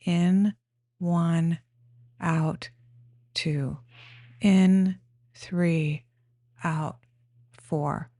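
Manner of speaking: single words with a pause after each; breath counting. Shown as a demonstration. Guide showing how to count breaths up to four, breath counting. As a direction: count slowly in time with breathing. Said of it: "counting to the rhythm of your own breath".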